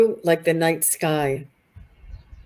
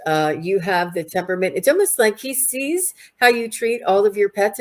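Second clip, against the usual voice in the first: ordinary talk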